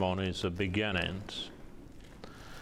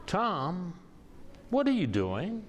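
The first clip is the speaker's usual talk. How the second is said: imitating a taken-aback colleague, higher-pitched; higher voice, questioning, without shouting; puzzled questioning; 7 semitones higher, slower than usual. Colleague questions the poet across the supper table, puzzled. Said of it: "with a strange look on her face".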